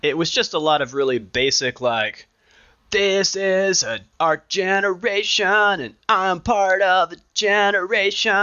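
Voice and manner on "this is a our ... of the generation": mocking earnest rocker. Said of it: "he's pointing at himself".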